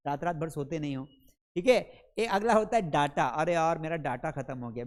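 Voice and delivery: normal talking voice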